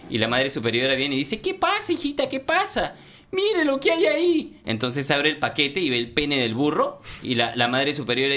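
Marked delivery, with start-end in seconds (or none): 1.4-4.6 put-on high falsetto old nun; Mother Superior asks the young nun what happened; fussing Mother Superior.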